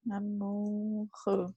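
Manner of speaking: "Amoureux" said slowly and softly, each syllable clear; teacher models "Amoureux" slowly; slow, soft model word. Shown as a demonstration. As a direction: spell out "Amoureux" softly for the student.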